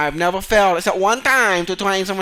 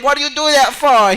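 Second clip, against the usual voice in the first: louder, higher exasperated scold; mock scolding; 6 semitones higher, 6 dB louder than usual. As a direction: tell off the student. Scolding the student.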